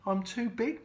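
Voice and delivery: beached whale's mild lament; moaning whale. Acted. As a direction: groan about being stuck. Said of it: "moaned the whale".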